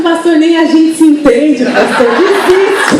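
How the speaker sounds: laughing through the words, slowing on desiste; laughing reply. Retold or imitated. Pastora laughing as she tells Luciano to give up. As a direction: laugh as you tell him to give up. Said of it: "a resposta dela assim".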